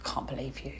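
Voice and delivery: hissed under the breath, very soft; whispered disbelief. Retold or imitated. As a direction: mouth it at her, shocked. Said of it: "She was like".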